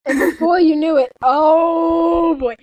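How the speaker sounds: loud, overdramatic buildup; hammed-up excitement. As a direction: build it like a melodrama. Building romance climax.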